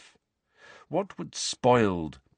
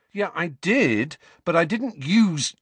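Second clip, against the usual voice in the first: higher, heated start of a defence; angry; 10 semitones higher, 4 dB louder than usual. A narrator's reading. Boy defending himself to uncle and aunt, angry. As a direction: begin an angry self-defence. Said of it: "Harry began angrily".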